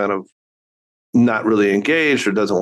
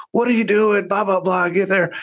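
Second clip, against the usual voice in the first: higher, scolding dad yelling from the sideline; exasperated dad; 9 semitones higher than usual. Retold or imitated. Dad scolding his son on the soccer field, mock yell. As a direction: snap at the kid picking flowers. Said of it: "And I'm like".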